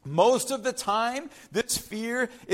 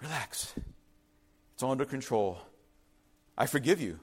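soft, low, calming; reassuring Jesus; 8 semitones lower, 6 dB quieter, faster than usual. Acted. Jesus forgiving the hiding disciples, soft and calm. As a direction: settle them down gently. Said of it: "But Jesus comes".

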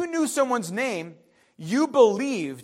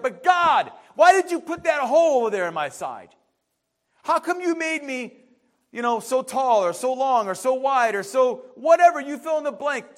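louder, higher indignant protest; yelling at God; 3 semitones higher, 4 dB louder than usual. Acted. Clay yelling at God, loud and indignant. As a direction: shout your grievances up at heaven. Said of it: "clay that yells at God".

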